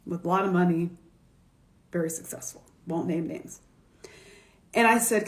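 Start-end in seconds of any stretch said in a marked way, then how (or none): 1.9-3.6 whispered aside. Real, not acted. Hiding a wealthy client's identity, whispered aside. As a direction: whisper it low and secret.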